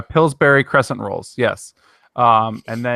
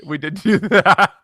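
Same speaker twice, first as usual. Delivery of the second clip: laughing; 6 semitones higher, 3 dB louder, slower than usual. Real, not acted. Laughing at shared memory.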